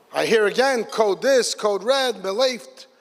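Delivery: ordinary delivery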